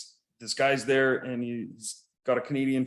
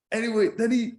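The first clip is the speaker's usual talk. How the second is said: ordinary talk, nothing marked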